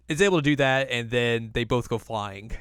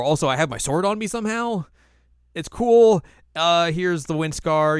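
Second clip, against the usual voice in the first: louder, higher mock-heroic announcement; cocky hero; 6 semitones higher, 6 dB louder than usual. Inuyasha announces his attack, loud mock-heroic. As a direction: call it out as a breezy action hero. Hero's triumphant return acted.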